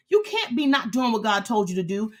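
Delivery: usual speaking voice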